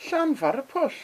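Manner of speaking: the way locals actually say "Llanfairpwll"; local short name. Shown as a demonstration. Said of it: "here's what people call it".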